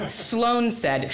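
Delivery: plain reading voice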